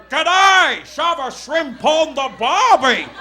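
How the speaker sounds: loud, slow mock-Australian shout; hammed-up Aussie accent. Audience member trying the comedian's accent, exaggerated loud Australian. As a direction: holler it in a broad fake Aussie. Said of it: "when you go".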